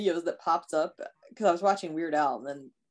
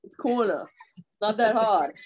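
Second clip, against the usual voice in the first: higher-pitched mock Long Island, r's dropped; imitated Long Island accent; 4 semitones higher, 4 dB louder than usual. Mimicking Long Island dad correcting her, heavy accent. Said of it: "belts out this Long Island accent".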